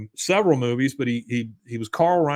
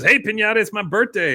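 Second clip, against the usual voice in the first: higher, louder imitated child; kid; 8 semitones higher, 4 dB louder than usual. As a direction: holler it like a birthday kid. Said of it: "the other kid says".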